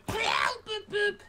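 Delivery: imitated beeping. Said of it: "I heard him go".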